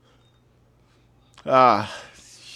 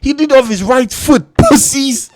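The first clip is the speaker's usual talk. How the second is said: high, loud outburst of disbelief; excited shouting; 11 semitones higher, 7 dB louder than usual. Real, not acted. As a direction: yell it like you can't believe it. Erupts watching Messi's play.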